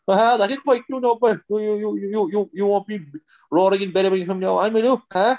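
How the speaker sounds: put-on a high, sing-song Kerry accent; comic Kerry accent. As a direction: play the old Kerry character, pitched high. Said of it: "things you can say in an accent that are funny".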